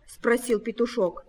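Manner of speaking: ordinary delivery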